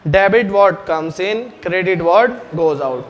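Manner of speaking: very slow, word by word, for notes; teacher dictating the rule to the class, slow and deliberate; slow dictation. Shown as a demonstration. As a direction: spell the rule out slowly so students can write.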